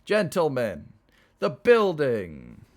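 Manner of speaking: high, loud toast with drawn-out last word; yelled toast. Acted. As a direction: yell it out to the whole table. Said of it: "and yelled".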